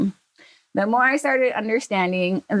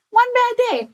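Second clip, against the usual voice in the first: much higher, laughing through it; laughing repeat; 3 dB louder than usual. Retold or imitated. Dad repeating his punchline to his daughter, laughing high. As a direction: crack up on the repeat.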